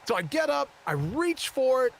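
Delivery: ordinary talk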